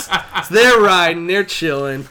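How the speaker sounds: usual speaking voice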